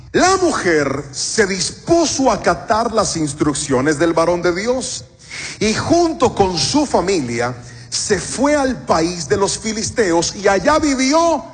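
slow, spaced-out verse reading; measured reading aloud with weighty pauses; deliberate Scripture reading. Shown as a demonstration. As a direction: read it unhurried, letting each phrase land. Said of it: "lo vamos a leer como está escrito".

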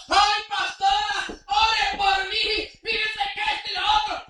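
loud, drawn-out pleading whine; mock whining believer. Churchgoer begging the pastora for prayer, hammed-up whine. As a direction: ham up the begging sinner.

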